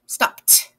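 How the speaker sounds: "stopped" ending in a crisp "t"; one syllable "stopped", ED sounding like "t". Shown as a demonstration. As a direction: release a clear "t" at the end of "stopped". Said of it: "E D turns into a t sound".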